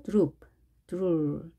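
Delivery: droop and drool with a long oo vowel; oo vowel demo. Shown as a demonstration. Teacher modelling the oo sound in dr words. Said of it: "자, 오오 발음, 우 발음이었죠".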